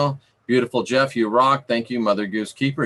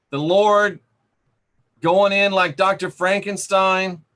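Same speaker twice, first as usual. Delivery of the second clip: rising preaching fervor; 6 semitones higher than usual. Acted. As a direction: build the outrage as you preach.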